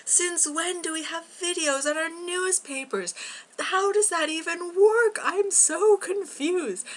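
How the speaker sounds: bewildered, squeaking out the questions; confused astonishment. Retold or imitated. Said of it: "I was like".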